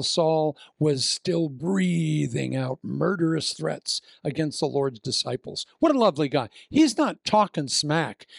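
ordinary talk

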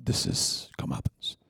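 low guilty whisper. Retold or imitated. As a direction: murmur to the others in dread. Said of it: "looking at each other going".

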